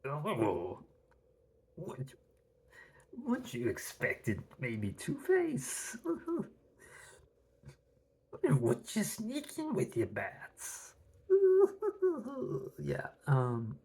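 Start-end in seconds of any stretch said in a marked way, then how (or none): 0.0-10.9 slow, theatrical cartoon villain voice; a comic-book Joker voice; Mark Hamill's Joker. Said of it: "Mark Hamill's Joker". 11.3-12.8 high, wild cackle; shrieking villain laugh; hysterical Joker laugh. Retold or imitated. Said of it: "laughing hysterically".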